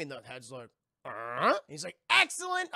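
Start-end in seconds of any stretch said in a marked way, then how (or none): 1.0-1.6 a wordless, questioning head sound; robot head. Retold or imitated. Revived head answers the doctor, robot grunt. 2.1-2.7 high, loud burst of praise; delighted doctor. Retold or imitated. Doctor praises the responding head, delighted.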